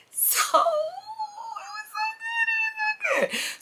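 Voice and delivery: choked up, overjoyed about church; so moved it squeaks out through tears; tearful excitement. Real, not acted. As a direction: voice cracks high into a squeal of joy. Overwhelmed recalling church service.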